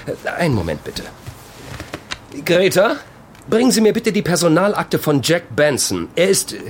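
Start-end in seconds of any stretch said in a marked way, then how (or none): 2.4-6.5 calling out. Acted. Boss calls to secretary Greta.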